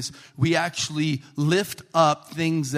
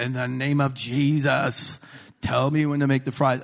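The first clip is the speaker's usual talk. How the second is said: mock fervent prayer. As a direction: intone it like a showy revival prayer. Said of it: "speak in tongues and say".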